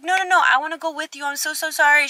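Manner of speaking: high, fast pleading apology; fast pleading friend. Retold or imitated. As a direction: imitate her begging to come. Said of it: "She's like".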